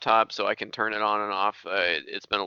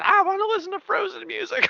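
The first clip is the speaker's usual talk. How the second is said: much higher, mock little kid whining; dad imitating his child interrupting a call, high and whiny. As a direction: play a toddler demanding a song. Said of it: "you could hear it going".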